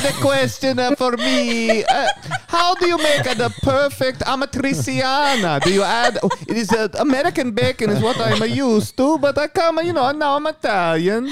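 high, laughing mock Italian accent; hammed-up Italian accent, giggling through it; fake Italian accent. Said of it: "Do you think you're better than us with that accent?".

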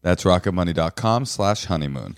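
upbeat sponsor pitch read to listeners. Acted. Host pitching a finance app to listeners, bright ad read. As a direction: sell the app like a podcast host.

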